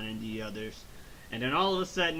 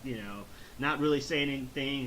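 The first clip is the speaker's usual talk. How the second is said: usual speaking voice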